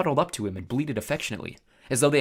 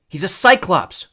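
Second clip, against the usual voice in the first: warned; 7 semitones higher, 11 dB louder, slower than usual. A narrator's reading. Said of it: "Grover warned".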